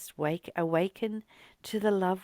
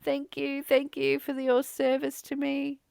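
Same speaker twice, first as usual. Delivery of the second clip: high, fond cooing to the car; loving thanks to car; 7 semitones higher, 3 dB louder than usual. Retold or imitated. Talking to her car at the wheel, fond high coo. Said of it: "run my hands around the steering wheel and say".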